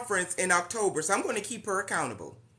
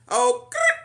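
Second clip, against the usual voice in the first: playful growl; 7 dB louder than usual. Real, not acted. Playful growl after coach joins.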